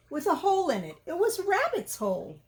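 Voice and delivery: ordinary delivery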